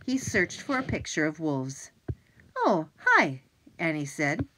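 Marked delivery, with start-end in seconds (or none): 2.6-3.4 high, loud surprised greeting. Acted. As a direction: caught off guard, bright hello. Annie greets unexpected arrival.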